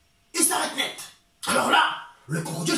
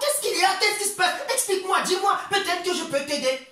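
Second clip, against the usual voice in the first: high concerned crocodile; 8 semitones higher than usual. Acted. Crocodile worried questions.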